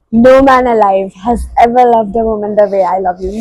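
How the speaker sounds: normal talking voice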